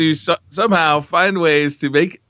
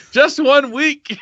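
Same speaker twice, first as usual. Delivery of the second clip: laughing; 11 semitones higher, much slower than usual. Real, not acted. Reading viewer question, cracking up.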